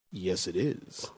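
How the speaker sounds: low, soft and slow; phone voice. Retold or imitated. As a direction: answer quietly like on the phone. Pastor answers in phone voice.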